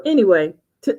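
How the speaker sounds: usual speaking voice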